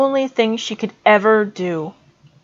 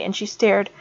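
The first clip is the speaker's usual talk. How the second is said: ordinary delivery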